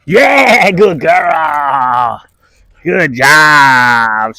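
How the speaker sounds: reward her with big praise; cheering the dog on; loud praise. Real, not acted.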